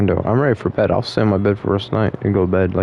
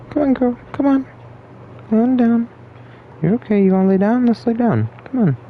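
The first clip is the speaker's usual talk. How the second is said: high, sing-song voice for a cat. Real, not acted. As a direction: coo at the kitty in a high voice. Coaxing the cat to lie down.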